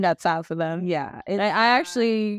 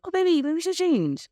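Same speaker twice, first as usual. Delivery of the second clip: high, sweet coaxing voice; sweet-talking partner; 8 semitones higher than usual. Retold or imitated. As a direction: gently coax your man to change clothes. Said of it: "So I'm just like".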